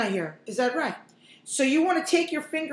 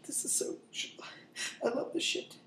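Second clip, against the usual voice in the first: hushed, pretend-teary whisper; mock emotional whisper; 13 dB quieter than usual. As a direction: whisper as if moved to tears by onions. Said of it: "tearing up right now".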